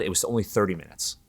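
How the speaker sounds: ordinary talk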